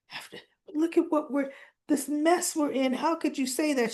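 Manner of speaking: imitating a dismayed protest; retold disbelief. Acted retort of dismayed Laura.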